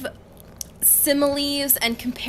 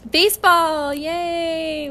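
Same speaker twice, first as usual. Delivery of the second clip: mock cheer; 6 semitones higher, 4 dB louder, much slower than usual. Cheering finally getting to baseball.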